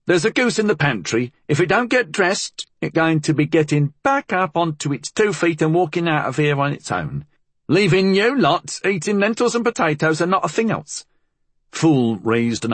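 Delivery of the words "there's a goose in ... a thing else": high-pitched, protesting housekeeper voice; scolding housekeeper. A narrator's reading. Housekeeper protests about the goose in the pantry, scolding.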